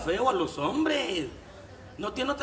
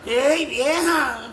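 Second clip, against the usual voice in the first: drawn-out, louder call; slow, loud call across the room; 4 semitones higher, 5 dB louder, much slower than usual. Real, not acted. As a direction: stretch the call out slowly. Calling out to a woman.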